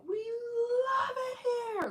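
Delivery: high, very slow, laughing cry; gushing travelers. Retold or imitated. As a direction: stretch it out in delight. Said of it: "They're like".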